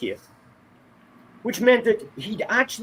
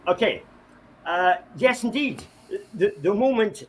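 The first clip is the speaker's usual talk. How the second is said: normal talking voice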